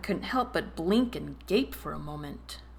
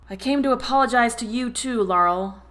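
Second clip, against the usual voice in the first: raised voice, louder; a little louder; 5 semitones higher, 6 dB louder than usual. A narrator's reading. David raises his voice to reach Laurel, louder. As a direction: call across the room to her. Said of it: "David said a little louder".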